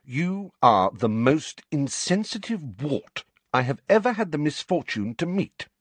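measured, high-pitched, haughty reproof; dignified. A narrator's reading. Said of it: "in a dignified voice".

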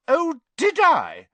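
high, loud, slow challenge; dangerously indignant. A narrator's reading. Hermione challenges Ron's disbelief, dangerously indignant. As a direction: throw it back at him, offended. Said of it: "her eyes flashed dangerously".

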